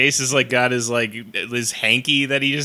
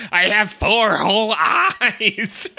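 slow high Guldo; 8 semitones higher, much slower than usual. Retold or imitated. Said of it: "Guldo's like".

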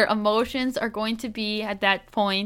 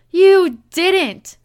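higher, louder, acted disbelief; alarmed interruption; 7 semitones higher, 10 dB louder than usual. A narrator's reading. Wife interrupting husband over his tattoo, acted alarm. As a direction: cut in with mock panic. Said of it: "the panic in her voice grew".

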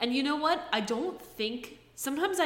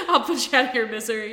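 louder, fast, through laughter; laughing; 4 semitones higher, 9 dB louder, faster than usual. Real, not acted. Host laughing as she finally gives up the answer. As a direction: laugh while giving in to end the guessing.

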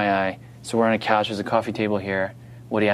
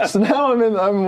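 laughing; 10 semitones higher than usual. Real, not acted. Laughing through the story.

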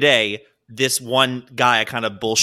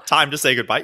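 normal talking voice

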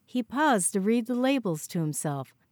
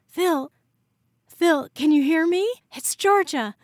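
much higher, much louder alarmed calls; frantic calling; 9 semitones higher, 7 dB louder than usual. Acted. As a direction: shout his name to reach him. Calling to collapsed friend.

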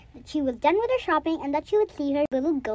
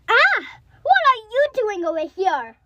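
startled baker; 7 semitones higher, 4 dB louder than usual. Acted. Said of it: "very surprised and scared".

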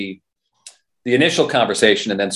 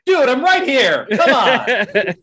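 mock protest; much slower than usual. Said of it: "I stick my head out from the curtains like".

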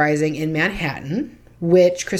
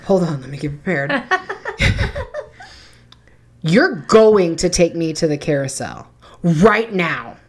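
a hint of a bratty kid; bossy kid. Kid ordering the mom to the carousel, bossy. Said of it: "This kid looks at me and says".

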